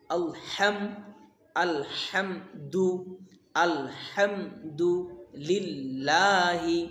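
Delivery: slow, build আলহাম to আলহামদুলিল্লাহি word by word; step-by-step Arabic teaching; slow building recitation. Shown as a demonstration. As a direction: stretch each syllable. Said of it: "প্রত্যেকটা উচ্চারণ আমি যেমনে উচ্চারণ করি".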